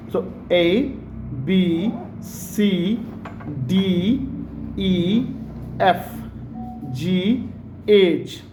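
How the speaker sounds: letters drawn out slowly in an American accent; careful American-accented alphabet. Shown as a demonstration. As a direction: spell it out American-style, one letter at a time. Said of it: "American accent में".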